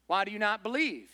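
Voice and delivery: ordinary talk, nothing marked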